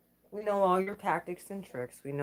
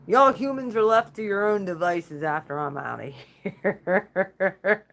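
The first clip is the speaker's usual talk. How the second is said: laughing declaration; 3 semitones higher, 7 dB louder than usual. Real, not acted.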